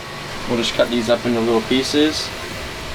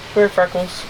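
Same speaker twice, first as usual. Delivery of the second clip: coaxing, higher pet-talk call; talking to fish; 5 semitones higher than usual. Real, not acted. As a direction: sweet-talk the stingray over. Calling the stingray up.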